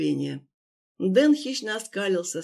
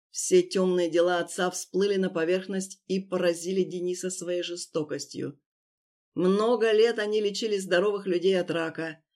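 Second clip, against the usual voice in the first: plain reading voice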